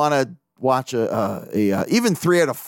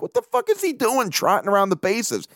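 high-pitched disbelief; puzzled, higher-pitched self-quote; retold bafflement; 7 semitones higher than usual. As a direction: replay a baffled double take. Retelling his baffled reaction to TV.

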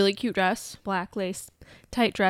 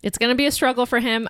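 ordinary talk, nothing marked

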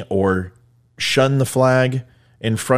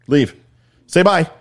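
normal talking voice

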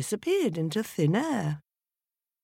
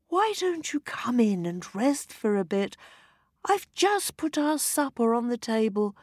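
higher, kind wife voice; 9 semitones higher than usual. A narrator's reading. Wife welcomes the old lady, lightly voiced. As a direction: gently offer help to a tired guest.

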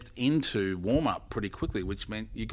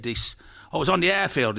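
higher-pitched Irish storyteller; Irish grandfather; 6 semitones higher, 4 dB louder than usual. Acted. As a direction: carry on the yarn in an Irish accent. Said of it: "This Irish grandfather's been invited".

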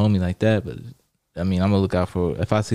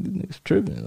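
mock disbelief; 10 semitones higher, 4 dB quieter than usual. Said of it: "I'm like".

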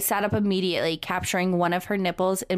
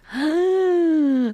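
long, high gasp; loud drawn-out gasp; 10 semitones higher, 6 dB louder than usual. Real, not acted. Reader reacts to the steamy scene with a loud gasp.

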